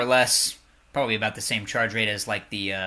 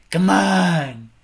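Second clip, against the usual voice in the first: loud urging; 4 semitones higher, 9 dB louder than usual. Real, not acted. Urging the game on, louder.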